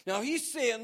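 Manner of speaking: ordinary talk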